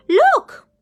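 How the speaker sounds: very high, loud excited look; excited cry. Acted. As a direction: point and shout it. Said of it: "cried Mister Tickle".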